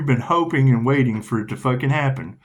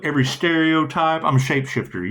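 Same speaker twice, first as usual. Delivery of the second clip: ordinary delivery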